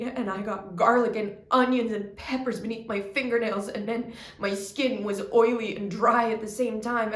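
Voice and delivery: loud, relentless list gathering force; intense building rant. Acted. Relentless list of kitchen hardship.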